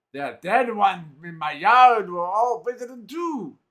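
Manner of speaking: put-on slow, grim line in a Hadati accent. Scowling man mocks the visitors, Hadati accent. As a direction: menace them slowly with a Hadati accent. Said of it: "with a heavy Hadati accent".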